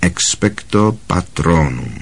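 soft, slow, low-key incantation; quiet repetition. A narrator's reading. Harry repeats the formula after Lupin, quietly. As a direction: try the spell out under your voice, slowly. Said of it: "opakoval po něm tiše Harry".

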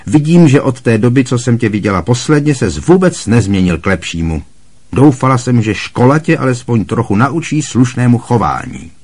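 loud, high scornful rant; scornful Aunt Marge. A narrator's reading. Aunt Marge scorning Harry over tea, loud and contemptuous. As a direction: lecture him with contempt.

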